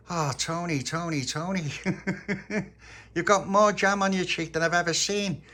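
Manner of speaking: high, teasing character voice; Little Pappy, amazed. A narrator's reading. Little Pappy spotting jam on Tony's cheek, high and amazed.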